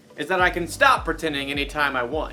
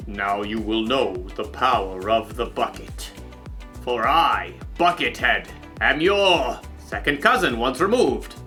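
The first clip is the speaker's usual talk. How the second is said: low, menacing villain voice; slow theatrical villain menace; 5 semitones lower than usual. Pretend. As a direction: play the scheming bad guy. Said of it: "pretend to be the villain".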